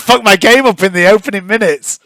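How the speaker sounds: high, loud mock dismay; exaggerated worried wail; imitating a worried friend. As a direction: mimic a game master's alarm. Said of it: "And you were like".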